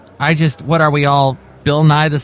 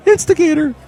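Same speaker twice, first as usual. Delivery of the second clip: "Instigator" squeaked very high; Floyd voice; 14 semitones higher, 3 dB louder than usual. Acted. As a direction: pipe "Instigator" up high like the floor man. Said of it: "I'm Floyd the Floor Man".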